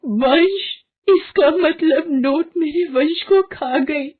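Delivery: high, tearful sobbing speech; crying mother. Acted. Varsha crying to her husband after losing her son. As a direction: weep over the lost child.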